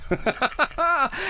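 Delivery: high-pitched chuckling laugh; amused laugh. Real, not acted. Host joking to guest about social distancing, high laugh. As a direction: laugh high at your own joke.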